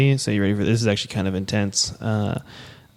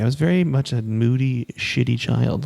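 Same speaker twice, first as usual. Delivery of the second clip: ordinary delivery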